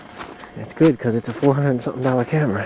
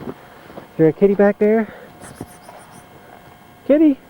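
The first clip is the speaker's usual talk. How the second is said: calling the cat; 8 semitones higher than usual. Real, not acted. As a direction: call out high to find the cat.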